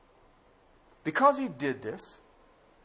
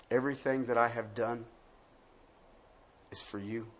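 soft, low, hushed preaching; tender hushed delivery; quiet intimate sermon; 6 semitones lower, 6 dB quieter than usual. Acted. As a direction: speak gently, almost confiding. Opening intimate quiet passage.

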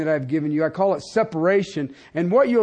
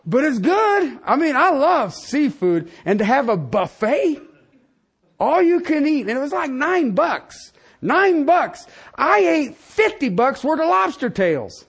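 higher and louder, gushing about the buffet; excited raving; 7 semitones higher, 3 dB louder than usual. Real, not acted. Preacher telling congregation about the buffet, gushing. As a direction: rave about a bargain feast.

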